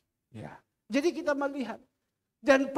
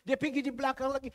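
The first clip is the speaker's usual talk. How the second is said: ordinary delivery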